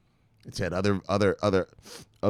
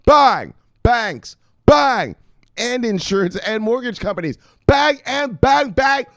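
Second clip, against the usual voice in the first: louder and higher on each bang; hyped reading; 12 semitones higher, 8 dB louder, slower than usual. Real, not acted. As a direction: slam every bang. Excitedly reading expansion list.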